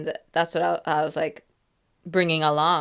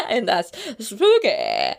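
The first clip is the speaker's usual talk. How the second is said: drawn-out, louder mock-spooky call; playful spooky; 3 semitones higher, 6 dB louder, slower than usual. Vlogger playing up the spookiness of the park, playful spooky. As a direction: stretch it out like a playful ghost story.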